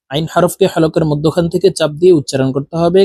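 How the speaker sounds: ordinary talk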